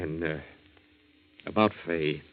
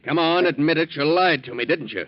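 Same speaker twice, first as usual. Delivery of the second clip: angry demand; 6 semitones higher, 4 dB louder than usual. Acted. Danny confronts lying Joe.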